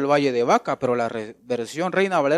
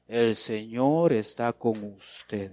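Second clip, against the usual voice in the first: slow demo; 4 semitones lower, much slower than usual. Shown as a demonstration. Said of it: "Dígale al que está a la par suya".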